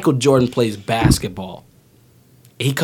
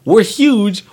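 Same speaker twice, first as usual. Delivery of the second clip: boasting monsters; 8 semitones higher, 5 dB louder than usual. Retold or imitated. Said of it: "they're like".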